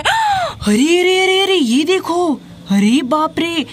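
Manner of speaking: sharp gasp then a high shouted "arey"; startled cry; shocked gasp. Acted. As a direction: gasp in horror at the sight. Finds dead body in well.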